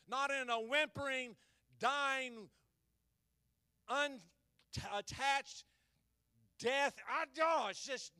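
loud fiery preaching; preaching at full force. Acted. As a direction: preach it out to the congregation.

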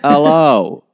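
booming mock Yorkshire phone greeting; imitated Yorkshire accent. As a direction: mimic a very loud Yorkshire hello. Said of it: "every time I ring one of you".

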